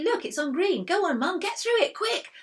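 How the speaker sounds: high, loud and pushing; urgent excited child. A narrator's reading. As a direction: hurry her on, eager. Stevie urging Mum.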